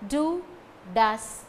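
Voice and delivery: normal talking voice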